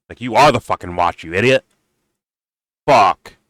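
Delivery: ordinary delivery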